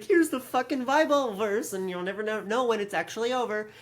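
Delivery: high mock-preachy announcer; mocking Chris. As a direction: parody her bubbly host voice. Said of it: "she's like".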